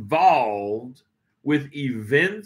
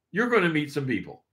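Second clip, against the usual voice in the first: usual speaking voice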